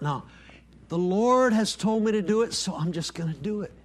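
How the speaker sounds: ordinary talk, nothing marked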